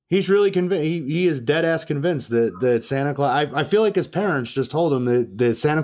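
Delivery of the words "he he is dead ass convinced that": ordinary delivery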